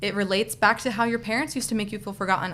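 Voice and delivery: ordinary talk, nothing marked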